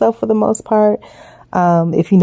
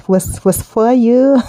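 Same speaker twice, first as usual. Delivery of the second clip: put-on slow, halting try at a Jamaican accent, pitched up; half-hearted Jamaican accent she gives up on; attempted Jamaican accent; 5 semitones higher, slower than usual. Quoting a Jamaican actress, trying her Jamaican accent. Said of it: "she said it in the Jamaican accent".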